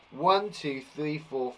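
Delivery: ordinary talk, nothing marked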